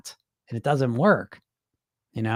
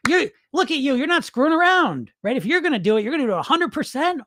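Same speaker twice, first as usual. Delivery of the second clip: higher, teasing sing-song; mock them like a gushing fan; sarcastic mockery; 8 semitones higher than usual. Host teasing dieters about their all-or-nothing plans, sarcastic and higher.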